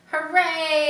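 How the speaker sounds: high, loud hooray; cheering. Acted. The animals cheer Charlotte, loud hooray. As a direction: cheer together. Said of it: "everybody cried".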